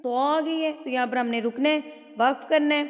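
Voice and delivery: usual speaking voice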